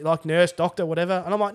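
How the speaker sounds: ordinary delivery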